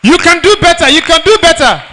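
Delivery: urging the crowd. Real, not acted. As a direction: pump up the audience, loud and high.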